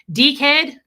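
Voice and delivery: loud, high deekhead with a rising Aussie ee; mock Australian accent. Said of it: "That's how they say it in their language".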